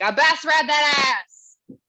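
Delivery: loud, slow slangy shout; mock slang brag, loud and slow. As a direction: yell it like a street boast. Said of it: "which sounds like slang".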